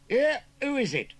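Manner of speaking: high, loud Cockney shout; demanding. Acted. Young scarecrow challenging whoever knocked, demanding shout. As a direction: demand it sharply at the door. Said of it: "demanded the young Scarecrow".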